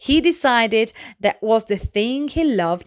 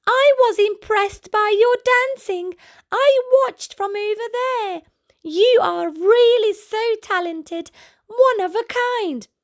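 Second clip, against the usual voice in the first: high giraffe voice; 11 semitones higher than usual. Acted. Gertie the giraffe praising Alfie, high and admiring.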